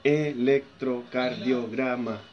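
"Electrocardiograma" slow, low and soft, syllables apart. Shown as a demonstration. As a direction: count out each syllable of "Electrocardiograma". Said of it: "otra, una palabra larga".

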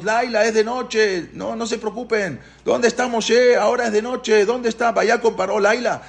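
insistent calling, high throughout; high-pitched pleading. Retold or imitated. Paró assuring everyone it is night, high acted pleading. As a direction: keep appealing to everyone in a high voice. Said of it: "Le decía a todos".

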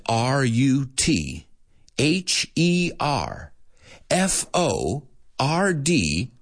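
slow, spaced letters with pauses; slow spelling. Shown as a demonstration. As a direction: spell each letter slowly for learners. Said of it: "at a more human pace".

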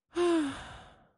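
put-on a high, airy girl's "ah" sigh. A narrator's reading. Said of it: "suspiró ella".

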